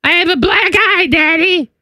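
put-on very high little girl whimpering to daddy. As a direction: play the hurt daughter, high and small. Daughter voiced complaining to dad.

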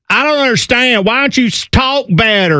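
ignorant complainer in a put-on Southern accent; mock Southern accent. Host mocking people who deride accents, hammed-up Southern accent. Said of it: "they're like".

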